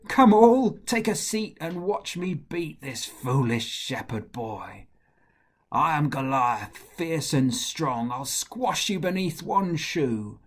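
loud, scornful boast with leaps in pitch; taunting giant. Acted. Goliath mocks the shepherd boy before the fight, loud taunt. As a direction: bellow the opening, then settle into menace.

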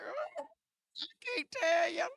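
high, slow, tearful cry through the words; barely getting it out between sobs; choked sobbing. Retold or imitated. Woman tells her friend through tears, sobbing. Crying at women's conference retold.

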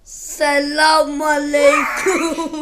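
very slow, loud, stretched greeting; drawn-out shout. Pretend. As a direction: call it out long and loud.

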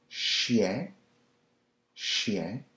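chien with a nasal French ending. Shown as a demonstration. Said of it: "if you put the C H before".

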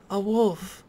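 gasp, then high and amazed; astonished at a rare find; surprised gasp. Real, not acted. Spots a wolf, gasps.

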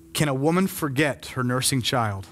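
low, hushed closing reading; low, measured preacher's voice; solemn scripture reading. Real, not acted. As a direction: read it tenderly with long pauses.

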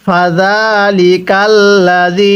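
drawn-out Arabic recitation, slow; slow Quran recitation. Shown as a demonstration. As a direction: recite slowly for students to repeat. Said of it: "তাহলে আমার সাথে পড়ুন". (ধীরে কুরআন তিলাওয়াত)

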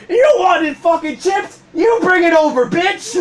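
high mock shout; hammed-up yelling at a coworker; retold angry retort. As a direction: throw it back in exaggerated, high-pitched outrage. Acting out imagined rant at coworker.

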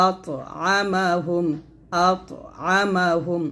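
আতআমাহুম very slow and drawn out; slow model recitation. Shown as a demonstration. Teacher modelling the word for pupils, very slow recitation. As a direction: stretch out each syllable of আতআমাহুম. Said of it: "এরকম".

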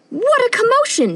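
ordinary delivery, nothing marked

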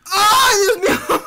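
high, loud cry of alarm; startled yelp. Real, not acted. Gamer yelping as his wrestler gets hit, loud. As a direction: yelp as if just got hit.

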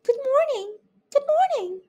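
very high squeaky mice greeting; tiny mice. A narrator's reading. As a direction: chirp it like two tiny mice. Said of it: "said the two mice".

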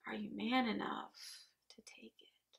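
sudden hush after shouting, fading away; quiet challenge. Acted. Boss daring salesmen to take it, quietly. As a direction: let the challenge die down to almost nothing.

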